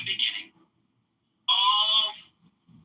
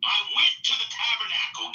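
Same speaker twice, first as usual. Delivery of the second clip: usual speaking voice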